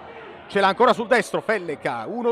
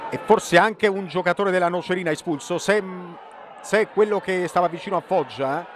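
normal talking voice

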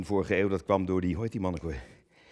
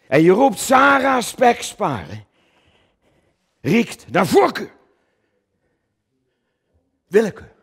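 indignant shout; 12 semitones higher, 11 dB louder than usual. Acted. Actor accusing Coen of favouritism, indignant and loud. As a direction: hurl the charge at him.

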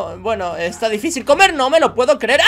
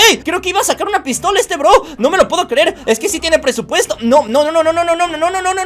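ordinary talk

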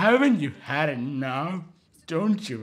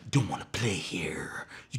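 whisper; 6 semitones lower, 6 dB quieter than usual. Real, not acted. Speaker whispering.

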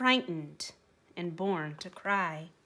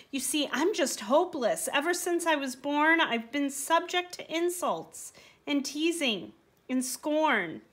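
higher, anxious pleading; dreadful protest; 6 semitones higher than usual. Acted. Timid donkey insisting he is too weak, high anxious pleading. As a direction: talk yourself small and hopeless. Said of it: "cried the donkey with dread".

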